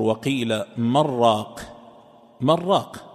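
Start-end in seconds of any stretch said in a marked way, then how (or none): 0.0-1.6 "وقيل من راق" said as "مرّاق"; slow, nun merged into a doubled ra; assimilated reading. Shown as a demonstration. Sheikh shows how the majority reading sounds, merged. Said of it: "كما يقرأها الجمهور".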